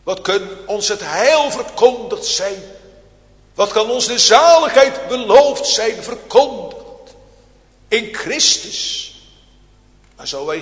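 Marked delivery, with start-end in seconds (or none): 0.0-9.1 loud, rising preacher's cry; fiery preaching. Real, not acted. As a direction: build the questions up to a loud climax.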